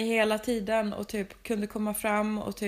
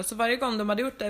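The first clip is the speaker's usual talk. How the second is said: ordinary talk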